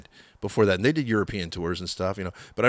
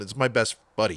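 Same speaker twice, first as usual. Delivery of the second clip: ordinary talk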